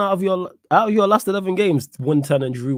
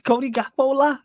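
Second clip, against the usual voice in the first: high, squeaky mock Scouse; Scouse accent; 7 semitones higher, slower than usual. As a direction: push the voice way up into Liverpool accent. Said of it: "All these accents we're mimicking".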